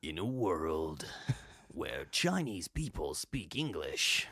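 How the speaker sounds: slow, portentous trailer narrator; movie trailer voice. Shown as a demonstration. Voice actor showing the voice he wants to perfect, movie trailer narration. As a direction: intone it with dramatic drawn-out words. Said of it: "the movie trailer voice".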